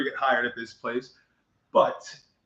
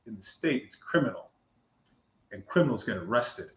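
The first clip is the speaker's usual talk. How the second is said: softer, measured pronouncement with pauses; relishing sergeant; 3 semitones lower, 7 dB quieter than usual. Retold or imitated. Sergeant announces an arrest to driver, quietly relishing it. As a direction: savor it like a rehearsed cop line. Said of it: "you had too much fun saying that".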